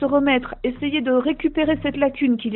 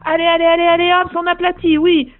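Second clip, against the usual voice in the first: cheering shout; 4 semitones higher, 6 dB louder than usual. Acted. Coach cheering players' try.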